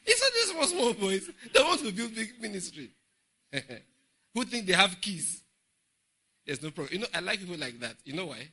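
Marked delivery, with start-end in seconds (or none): 0.0-5.4 high, loud, mocking burst; scornful exclamation. Real, not acted. Preacher scorning young ministers, high and loud.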